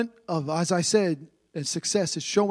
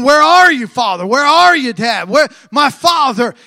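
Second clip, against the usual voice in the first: anguished calling out; 4 semitones higher, 10 dB louder than usual. Retold or imitated. Isaac calling out to father.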